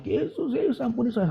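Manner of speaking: high, soft mock cry for mercy; retold crying out. Penitent crawling to the preacher begging forgiveness, mimicked high cry. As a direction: imitate desperate shouting without the volume. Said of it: "Dia teriak-teriak".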